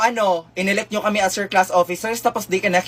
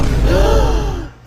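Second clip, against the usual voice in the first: amazed gasp; 7 semitones higher, 4 dB louder than usual. Acted. Shock at perfect score.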